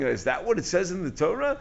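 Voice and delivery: "Torah" lifted at the end as a question. Shown as a demonstration. Son querying his father's conduct, "Torah" rising as a question. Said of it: "as a question".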